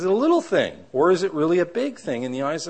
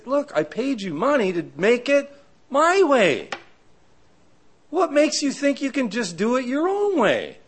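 indignant homeowner; 10 semitones higher than usual. Retold or imitated. Homeowner confronting the builder.

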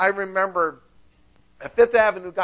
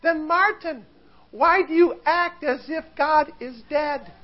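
high mock wife voice; Katie; 8 semitones higher than usual. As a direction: question the husband in a high put-on voice. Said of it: "she looked at him".

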